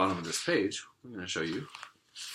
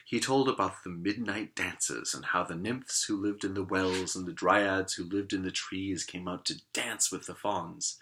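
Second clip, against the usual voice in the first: usual speaking voice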